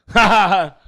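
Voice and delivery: short, loud forced ha ha ha; fake laugh. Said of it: "And I was just like".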